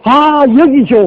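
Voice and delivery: 여기 조 high and drawn out after a long 아; imitated greeting mispronounced. Stranger tapping the pastor in recognition, high stretched mispronunciation. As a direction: exclaim a high, slow 여기 조. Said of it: "와서 탁 치면서".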